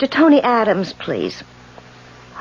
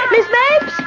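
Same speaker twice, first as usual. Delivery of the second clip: panicked scream for help; 10 semitones higher than usual. Acted. Landlady screaming for the handyman, panicked. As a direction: shriek for someone to come.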